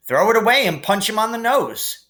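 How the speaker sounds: just sock him; lightly voiced Ron. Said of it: "Ron suggested".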